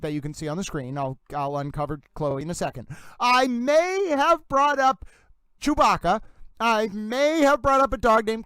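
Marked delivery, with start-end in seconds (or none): none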